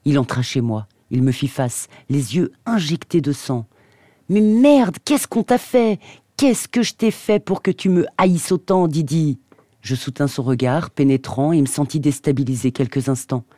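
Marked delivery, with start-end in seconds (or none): 4.3-9.4 éclat de colère. A narrator's reading. Drew explose de colère.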